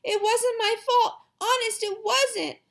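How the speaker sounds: put-on high, upset little-girl protest; girl crying out. A narrator's reading. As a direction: plead innocence in a high child voice. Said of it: "Sheila cried".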